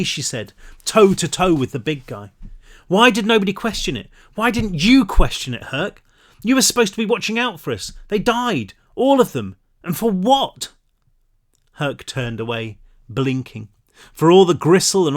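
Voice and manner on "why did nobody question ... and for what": higher, fast, accusing; grieving anger. A narrator's reading. Pan blames Herc for the deaths, anguished anger. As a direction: rail at him for failing them.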